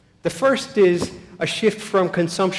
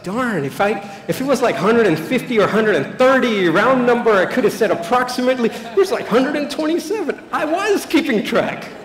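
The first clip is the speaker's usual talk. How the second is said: mock exasperated pilgrim; 6 semitones higher, 5 dB louder than usual. Mocking pilgrim counting steps.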